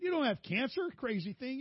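high-pitched mock scolding; exasperated wife. Wife scolds her fretting new husband, exasperated. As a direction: imitate a wife brushing off a silly fear.

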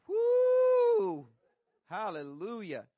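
joyful whoop into a shout; preacher whoop. Real, not acted. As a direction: let out a preacher's woo. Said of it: "when you get the preacher voice going".

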